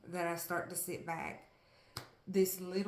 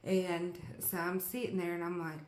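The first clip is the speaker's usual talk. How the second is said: ordinary talk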